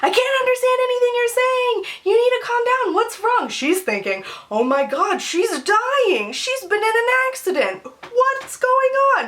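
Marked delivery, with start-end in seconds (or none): 0.0-3.5 high-pitched mock-alarmed mom voice; hammed-up maternal alarm, pitched way up; imitating frantic mother. Acts out her worried mother on phone. 4.5-9.2 mock panic climbing higher and higher; hammed-up alarm, stretching out the final question; imitating panicked mother. Voices mother's panicked thoughts.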